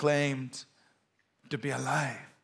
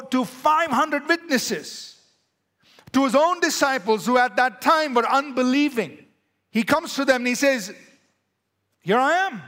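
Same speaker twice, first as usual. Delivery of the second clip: loud, higher, driving sermon voice; full-force preaching; 8 semitones higher, 5 dB louder than usual. Acted. Preacher proclaiming the resurrection to the congregation, fervent. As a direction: preach it with fire.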